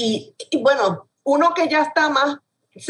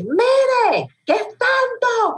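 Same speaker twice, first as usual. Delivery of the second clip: clerk shouting; much slower than usual. Retold or imitated. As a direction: shout each word as if to a deaf person. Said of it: "que empieza a gritarle".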